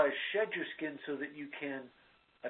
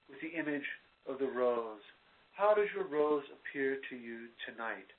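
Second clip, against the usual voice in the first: guided meditation; 3 semitones lower than usual. Acted. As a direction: ease the listener into stillness. Leads a guided visualization.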